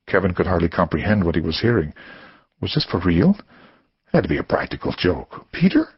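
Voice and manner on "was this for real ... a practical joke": plain reading voice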